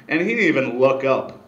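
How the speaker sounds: usual speaking voice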